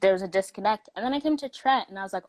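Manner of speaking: ordinary talk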